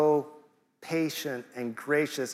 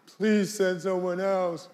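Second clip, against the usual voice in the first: put-on higher, whiny teenager voice; teenage whine; 5 semitones higher, slower than usual. Moses whining at God like a teenager, higher and slow. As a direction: whine it like a sulky teen. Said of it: "letting out one big whine".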